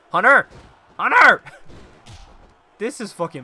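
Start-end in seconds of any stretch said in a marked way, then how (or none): 0.1-1.4 loud, high call of a name. Real, not acted. Player calling tag partner Hunter, urgent shout. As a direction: shout for your partner's attention.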